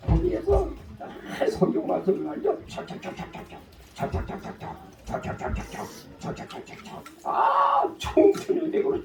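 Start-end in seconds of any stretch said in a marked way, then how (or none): 2.7-7.0 rapid breathy 착착 ticking sounds; whispered chalk tapping. Retold or imitated. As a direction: tick off the writing under your breath. Chalk writing on board. (속삭이듯 착착 소리 흉내)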